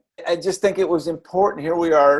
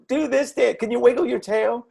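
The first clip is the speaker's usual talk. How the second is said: lightly mimicked kid's pleading, higher; imitating a child; 9 semitones higher than usual. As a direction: ask like a playful little kid. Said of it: "and they're like".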